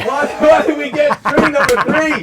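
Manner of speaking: high, loud and laughing. Real, not acted. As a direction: ask it while cracking up. Laughing at the odd label.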